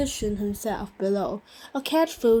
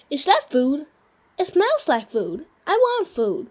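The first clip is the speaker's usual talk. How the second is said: lightly voiced eager dog, pitch climbing higher; a hint of a hungry pup begging, rising; barking dog; 5 semitones higher, 4 dB louder than usual. A narrator's reading. The dog Oscar chasing the groceries, lightly voiced eager barking.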